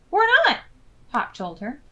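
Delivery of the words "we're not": gleeful. Acted. Hop gleeful reply.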